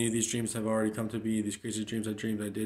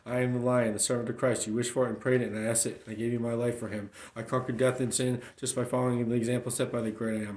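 ordinary talk

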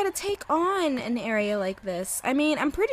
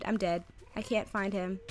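ordinary talk

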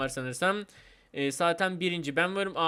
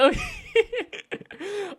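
high-pitched burst of laughing; helpless laughter. Real, not acted. Player who just fell down, bursting into high laughter. Falls during the cheering.

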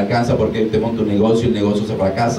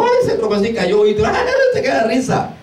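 high, giggling mock laughter at a fall; 11 semitones higher than usual. Preacher mimics someone laughing at a fall, giggling high.

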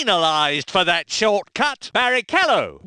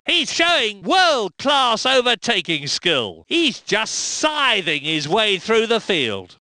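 ordinary talk

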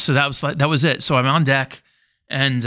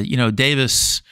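ordinary delivery

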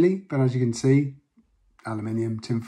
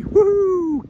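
excited cheer; 11 semitones higher than usual. Real, not acted. Cheering over a find.

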